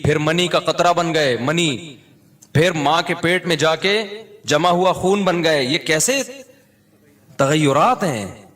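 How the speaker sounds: usual speaking voice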